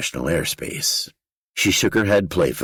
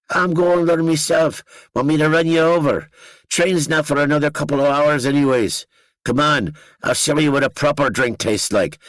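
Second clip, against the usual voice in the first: put-on Danny, thick accent; 11 semitones higher, 4 dB louder than usual. A narrator's reading. Danny offering the narrator a ride north, thick Northern Irish accent. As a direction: coax him along in broad Northern Irish. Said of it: "His accent thickened".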